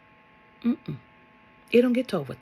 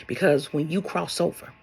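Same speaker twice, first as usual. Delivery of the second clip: ordinary talk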